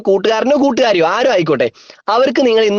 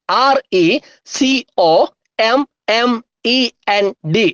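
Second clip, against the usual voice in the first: each letter stretched and set apart; measured letter-by-letter spelling; slow spelling; much slower than usual. Shown as a demonstration. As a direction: dictate the letters slowly with gaps. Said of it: "സ്പീഡിൽ പറഞ്ഞേ".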